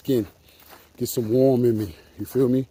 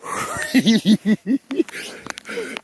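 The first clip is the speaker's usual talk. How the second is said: high-pitched laugh; long giggle, pitched up high; 10 semitones higher than usual. Real, not acted. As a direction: crack up in a high voice. Laughing while out on the lake.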